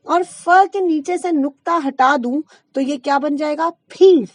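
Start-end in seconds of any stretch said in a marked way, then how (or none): 3.9-4.3 "फीस" with aspirated ph, loud; wrong ph sound. Shown as a demonstration. Teacher shows the wrong English word, ph sound. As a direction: say "फीस" as phees, loudly. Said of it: "फीस शब्द भी गलत है".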